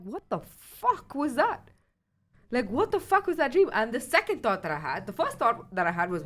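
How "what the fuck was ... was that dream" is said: slow, higher, dazed disbelief; waking up stunned; bewildered. Retold or imitated. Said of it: "and I'm like".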